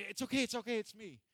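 high, loud mock reassurance; hurried human reassurance. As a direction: call out to calm someone you startled. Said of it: "totally different when one of us go".

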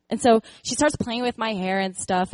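ordinary talk, nothing marked

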